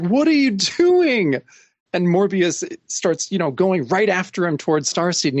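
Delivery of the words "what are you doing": high, slow, incredulous cry; alarmed Ghost Rider. Retold or imitated. Ghost Rider, seeing Morbius attack, slowly cries out in high disbelief. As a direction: stretch it out in disbelief. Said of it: "Ghost Rider's like".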